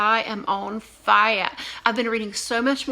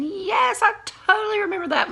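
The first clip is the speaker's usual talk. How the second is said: high, bright eager agreement; eager student; 10 semitones higher than usual. Retold or imitated. Teacher voicing a student who remembers, eager. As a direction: chime in like a keen pupil. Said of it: "some of you guys are saying".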